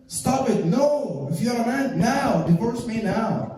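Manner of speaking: high-pitched, insistent mock quarrel; goading demand. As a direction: push and push, daring him.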